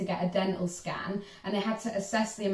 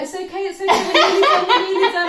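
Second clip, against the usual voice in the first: high, loud, half-laughing imitation of a calming dentist; laughing as she mimics the dentist calming her; mock soothing dentist; 11 semitones higher, 10 dB louder, faster than usual. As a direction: play the dentist going it's okay through laughter. Dentists soothing screaming patient.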